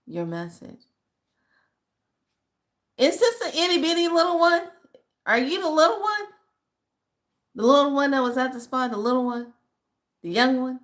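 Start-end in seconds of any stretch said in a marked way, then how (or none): 3.0-10.8 high, eager sing-song questions; high excited greeting. Real, not acted. Greeting a young follower excitedly.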